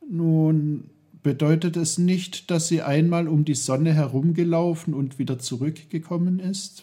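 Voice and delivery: put-on high, hurried boy working it out; Peter, eager child. Boy answers uncle's test with a question, eager.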